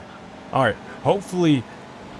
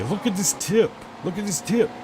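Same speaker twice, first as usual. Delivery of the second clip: much higher sing-song voice; mock outrage; 9 semitones higher than usual. Streamer mocking the shrinking tip, high mock voice. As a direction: pitch it way up in disbelief.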